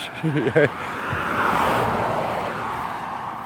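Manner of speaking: quiet airy chuckle; soft breathy laugh. Real, not acted. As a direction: laugh under your breath. Laughs after joke about friends.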